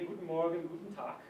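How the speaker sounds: guten Morgen, guten Tag in clean standard German; standard German greeting. Shown as a demonstration. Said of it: "If you're speaking standard German".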